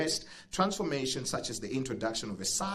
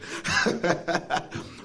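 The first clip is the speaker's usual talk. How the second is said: laugh; 7 semitones higher, 4 dB louder than usual. Real, not acted. MC laughs off the accent.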